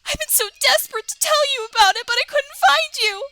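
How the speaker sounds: fast, high worried chatter; high girly Miriam voice. Acted. Streamer voicing Miriam fretting over the player. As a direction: gush with worry.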